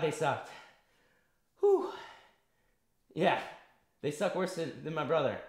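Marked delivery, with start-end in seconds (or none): 1.6-1.9 relieved whew. Real, not acted. Relief after the round.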